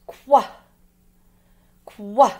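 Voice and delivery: "Qua" as one short loud kw burst; letter-sound demo. Shown as a demonstration. Teacher showing pupils what Q U says, short punched "Qua". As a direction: punch out "Qua" like K and W together, with a pause between. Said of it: "It's like that K W together".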